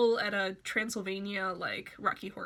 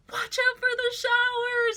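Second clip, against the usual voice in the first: high, loud hammed-up yell; screaming a warning from the police car; mock scream; 7 dB louder than usual. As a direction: scream it like a madman being dragged off. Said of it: "screaming at the top of his lungs".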